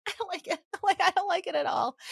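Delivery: high, squeamish little protest; mock squeamish. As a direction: recoil in imitated distress. Retelling squeamish panic at fish brush.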